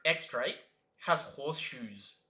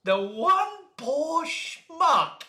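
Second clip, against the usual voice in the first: excited rant; 6 semitones higher, 8 dB louder, much slower than usual. Real, not acted.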